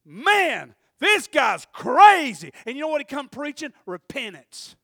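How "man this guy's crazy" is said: loud, slow, drawn-out shout of disbelief; stunned onlookers shouting at the strange preacher; crowd's amazed shout. Retold or imitated. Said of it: "everybody went".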